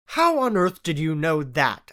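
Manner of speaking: usual speaking voice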